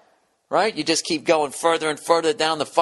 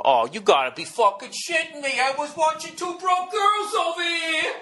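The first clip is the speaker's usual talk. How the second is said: mock indignant shout, much higher, dragging on the end; hammed-up furious whine, pitched way up; whiny outrage; 13 semitones higher than usual. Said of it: "they stand there and go".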